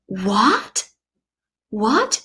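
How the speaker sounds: "What" sliding sharply upward; mock incredulous rising "What"; disbelieving. Patient hearing of eleven cavities, mock disbelief. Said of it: "You just cannot believe it".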